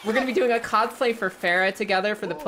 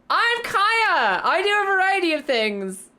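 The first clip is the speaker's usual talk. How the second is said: excited intro; 12 semitones higher, 4 dB louder, slower than usual. Acted. Intro in a bright announcer voice.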